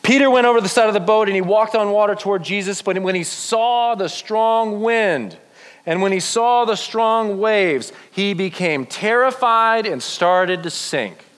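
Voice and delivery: high-pitched narration, rushing then stretching out; dramatic Bible reading. A narrator's reading. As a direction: build the drama as Peter starts sinking. Reads Bible story aloud to group.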